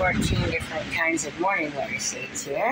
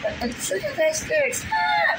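shout at cat. Real, not acted. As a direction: yelp at the cat to stop. Cat interrupting, shouting stop.

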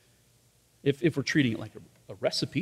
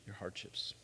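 whispered aside; 4 semitones lower, 18 dB quieter than usual. Shown as a demonstration. Preacher whispers the point to the congregation. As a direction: drop to a breathy whisper on your hardships. Repeated line, hushed second take.

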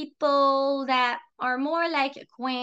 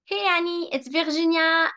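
French accent slipping out, higher pitch; 4 semitones higher than usual. Shown as a demonstration. Greeting Annie on a practice call, French accent slipping out. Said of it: "My French accent just got out".

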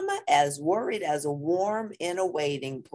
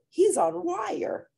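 put-on high child voice; 14 semitones higher, slower than usual.

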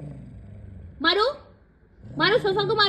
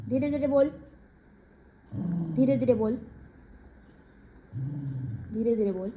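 fading lower and softer each time, down to a hush; speak softly; 8 semitones lower, 7 dB quieter than usual. Shown as a demonstration. Mother to pet, showing a soft voice, fading to a hush. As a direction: show the pet how soft "धीरे धीरे बोल" should sound.

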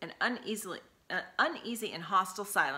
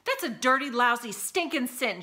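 high, loud scolding cry; Eva screeches in fury at William; screeching; 6 semitones higher, 6 dB louder than usual. A narrator's reading. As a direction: screech the words at him. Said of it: "Eva screeched at him".

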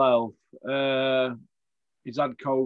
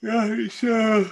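yawning; 8 semitones higher, 5 dB louder, slower than usual. Real, not acted. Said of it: "excuse me yawning".

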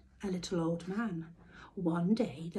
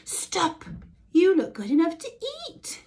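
high, loud call; crying out; 10 semitones higher, 7 dB louder than usual. A narrator's reading. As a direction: cry out after him. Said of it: "cried the girl".

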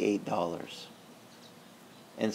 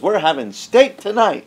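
overjoyed; 9 semitones higher, 8 dB louder than usual. Retold or imitated. Relieved joy after the check.

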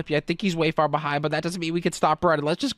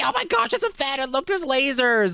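high-pitched surprise; play-acted alarm at a new hazard; startled exclamation; 7 semitones higher than usual. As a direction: yelp it, then stretch the last word. Runs into fan and lasers.

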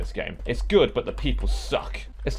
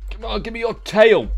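mock pleading; 9 semitones higher, 4 dB louder than usual. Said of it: "I was about to say".

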